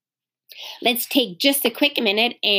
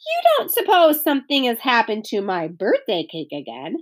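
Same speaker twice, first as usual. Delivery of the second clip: plain reading voice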